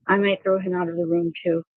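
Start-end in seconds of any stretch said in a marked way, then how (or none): none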